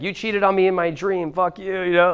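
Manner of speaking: high-pitched mock accusing girlfriend; mock jealous girlfriend.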